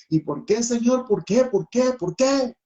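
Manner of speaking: insistent, high-pitched repeated demand; reproaching God. Retold or imitated. Father questioning God about his child, high insistent reproach. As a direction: re-enact pleading for an answer, pitch climbing. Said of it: "empecé a reclamarle a Dios".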